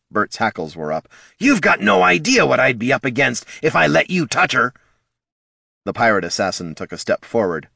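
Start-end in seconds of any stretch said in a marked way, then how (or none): 1.4-4.7 high, louder little kobold, bristling. A narrator's reading. Bert the kobold warning off the pirate, bristling. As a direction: kobold squaring up, hackles raised.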